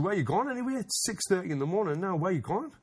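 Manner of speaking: high, startled where are you going; surprised question. Retold or imitated. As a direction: act amazed at the early hour. Asks mate where he's going.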